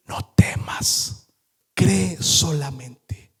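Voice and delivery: slow, gentle whisper. Acted. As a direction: hand on his shoulder, hush his fear. Jesus comforts frightened father. (susurro lento y tierno)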